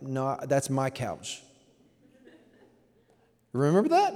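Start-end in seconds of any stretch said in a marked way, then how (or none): 0.0-1.4 put-on slow, possessive little-kid voice; childish claim. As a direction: drag out a stubborn no. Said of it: "and you're like".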